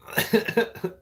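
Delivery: a sudden high cackle. Real, not acted. Streamer cackling high at his own joke.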